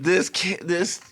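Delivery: high, slow, dripping sarcasm; mock-cheerful, say it through a smirk; facetious. Stuck at the merch table, lightly sarcastic drawl. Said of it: "in the most facetious way".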